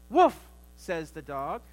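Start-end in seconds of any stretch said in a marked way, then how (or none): 0.1-0.4 loud, high woof; dog bark. Acted. Preacher voicing the dog in a joke, a loud bark.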